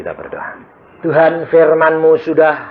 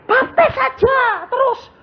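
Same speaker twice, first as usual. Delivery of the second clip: very high, slow, alien demon voice; slower than usual. Retold or imitated. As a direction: let the demon speak through him. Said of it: "dia ngomong sendiri". (suara setan yang sangat tinggi dan lambat)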